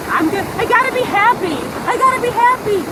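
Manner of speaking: mocking, high singsong chirp; mocking happy-talk. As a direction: parrot a positivity mantra with scorn. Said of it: "talk all this bullshit".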